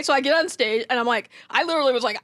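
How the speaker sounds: normal talking voice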